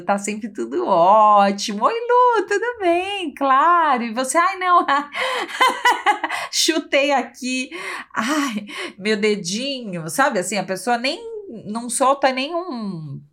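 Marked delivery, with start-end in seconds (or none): 1.8-4.4 high sugary always-fine voice; cheery fake voice. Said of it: "eu tô sempre sorrindo". 4.4-10.1 laughing off a stubbed toe; high giggly mock ouch. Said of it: "eu tô sempre sorrindo".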